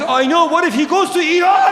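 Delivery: high, loudening frantic retort; mock panic. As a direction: act out alarm, climbing in pitch to the end. Acted panicked retort in argument.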